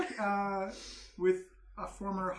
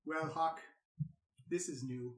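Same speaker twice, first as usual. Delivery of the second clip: sword voice; 4 semitones lower, 10 dB quieter, slower than usual. Acted. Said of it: "the sword says, out loud says".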